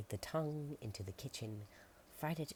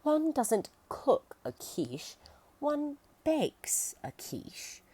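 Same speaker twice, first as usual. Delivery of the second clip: high, slow, prissy correction; fussy cannibal voice; 10 dB louder, much slower than usual. Acted. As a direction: correct him like a fussy gourmet.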